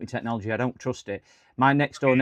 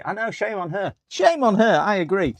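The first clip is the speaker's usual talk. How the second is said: mock scandalised; 7 semitones higher than usual. Agreeing in mock outrage with viewers.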